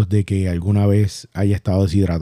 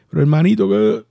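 lightly mimicked sister, voice pitched much higher; imitated crying sister; 10 semitones higher than usual. Sister tearfully protesting to her injured brother on the phone, imitated crying. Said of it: "mi hermana me llama llorando".

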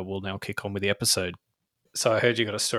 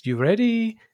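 high, louder singsong question; masseuse calling in; 12 semitones higher, 6 dB louder, slower than usual. Retold or imitated. Masseuse checking on a waiting client, high singsong call. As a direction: pitch it up like someone calling through a door.

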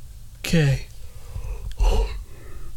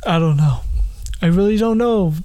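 usual speaking voice

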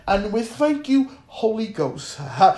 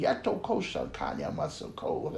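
whispered tongues; 7 semitones lower, 17 dB quieter than usual. Real, not acted.

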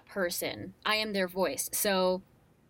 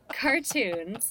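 ordinary delivery

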